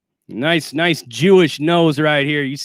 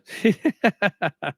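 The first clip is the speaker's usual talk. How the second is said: staccato run of chuckles. Real, not acted. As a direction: chuckle at the comparison. Laughs at resembling Jim Acosta.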